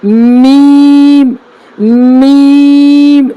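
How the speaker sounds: میم hummed very long; slow model of میم; drawn-out letter demo. Shown as a demonstration. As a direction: stretch میم slowly. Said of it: "اگلا حرف کیا ہے".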